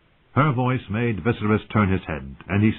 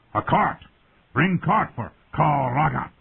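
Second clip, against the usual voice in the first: mocking warlord; 6 semitones higher than usual. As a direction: call it out to mock him. Warlord mocking drunk king.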